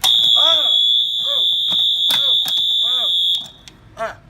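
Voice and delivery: loud high startled yelps; startled old man screams. Acted. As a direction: scream at each blast. Alarm goes off suddenly.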